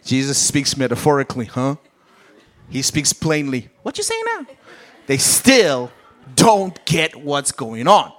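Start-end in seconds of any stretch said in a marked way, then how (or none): 3.8-4.5 high, baffled disciple voice; mock-baffled high whine; confused disciples. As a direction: squeak it out like a lost disciple.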